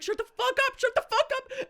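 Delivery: high, laughing "Shut the fuck up" with rising panic; panicked mock shriek. Scared cousin shushing drunk friend at gas station, high laughing panic. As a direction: squeal it half laughing in fake terror. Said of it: "and I was like".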